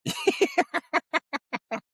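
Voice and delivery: high-pitched burst of laughter. Real, not acted. Laughing at friend's joke.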